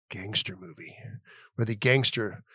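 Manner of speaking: breathy dramatic whisper. Acted. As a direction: whisper it like a secret. Setting up gangster movie pitch.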